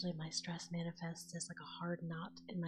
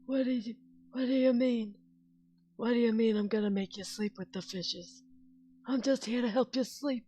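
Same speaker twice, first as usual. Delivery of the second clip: higher, louder mobster voice; mafia voice; 5 semitones higher, 7 dB louder than usual. Pretend. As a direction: play a mob boss offering sleep. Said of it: "with that mafia voice".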